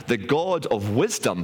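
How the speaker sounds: plain reading voice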